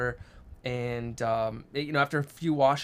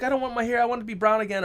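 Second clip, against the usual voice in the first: put-on much higher, whining child voice; whiny daughter; 9 semitones higher, faster than usual. Dad imitating daughter begging for her brown hair, high whine. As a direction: plead it like a kid who wants brown hair back. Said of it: "she's like".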